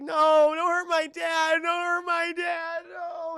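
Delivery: high, drawn-out wailing shout; hammed-up crying, loud and stretched; mock crying plea. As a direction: wail it like a pleading daughter. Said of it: "starts crying".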